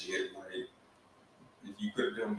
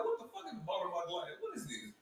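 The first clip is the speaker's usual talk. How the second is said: laughing mock outrage; 6 semitones higher, 10 dB quieter than usual. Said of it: "I was like".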